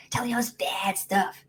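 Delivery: hushed, whispery insect chatter; little mosquito voice. Acted. Inner voice as a nagging mosquito, whispered and small. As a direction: whisper it like a tiny pest at the ear. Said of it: "that's what that little voice sounds like".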